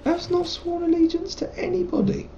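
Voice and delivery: high indignant protest; indignant squeak. Acted. Indignant high retort to NPC.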